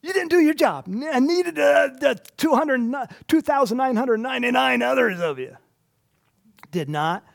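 high, loud mocking scold; rubbing it in. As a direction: jeer at the guard who failed. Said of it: "just kinda going".

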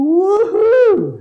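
very high, loud celebratory cry; joyful whoop. Acted. Pastor acts the forgiven servant whooping, loud high joy. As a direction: whoop like you just won.

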